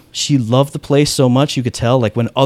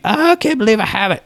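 giddy disbelief; 10 semitones higher than usual. Retold or imitated. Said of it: "Walking down the street".

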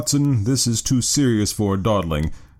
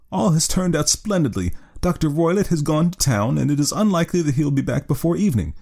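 high, eager woman's voice; joyful client; 6 semitones higher than usual. A narrator's reading. Client telling detectives the doctor is away, high and eager. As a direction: share good news eagerly, pitched up.

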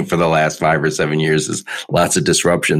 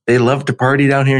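imitating sister; 8 semitones higher than usual. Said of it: "she goes".

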